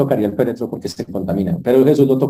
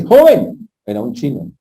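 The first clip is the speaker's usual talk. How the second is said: higher and louder, a commanding call; raised voice; 4 semitones higher, 6 dB louder, slower than usual. Retold or imitated. Jesus commanding the dead young man, loud. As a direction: call out to the dead youth with authority. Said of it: "eleva la voz".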